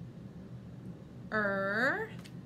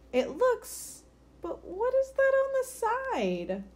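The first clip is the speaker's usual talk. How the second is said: squeaky higher little bird voice; 13 semitones higher than usual. Acted. Twin bird points at the stain, high and chirpy. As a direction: pitch it way up like a baby bird.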